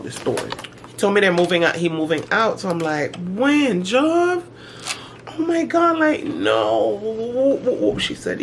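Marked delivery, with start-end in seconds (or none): 3.2-8.0 shocked disbelief. Retold or imitated. Reacting to son moving out.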